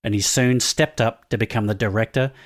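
scripted reveal read. Acted. Reads scripted mystery-guest bio.

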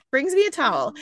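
laughing through the words, voice climbing high; giggling as she tells it; breaking into high laughter mid-line. Real, not acted. Laughing while retelling.

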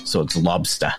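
"lobster" ending in ah, no r; "lobster" with the r turned to ah; Maine lobster. Shown as a demonstration. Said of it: "the R turns into an A H".

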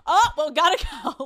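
high, loud, breezy goodbye; mock therapist cutting the session off; imitated dismissive therapist. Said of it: "such a classic therapist thing".

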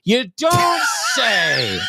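sarcastic. Pretend. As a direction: drag it out, mocking disbelief. Mock reaction to headline.

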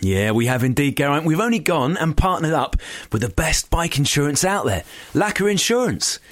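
lively sponsor announcement; scripted ad read. Acted. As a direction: sell it like a podcast promo.

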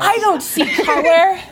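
high, loud parody of a professor; mock denial. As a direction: protest innocence in exaggerated pitch. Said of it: "oppression through denial".

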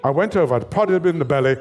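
usual speaking voice

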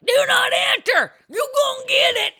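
high, loud backwoods holler; mock warning shout. Chains across the road warning drivers off, hammed-up shout. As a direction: holler it like a hostile landowner. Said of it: "and it's like".

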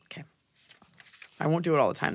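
ordinary talk

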